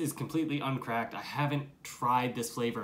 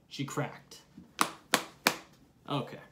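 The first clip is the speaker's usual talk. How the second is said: usual speaking voice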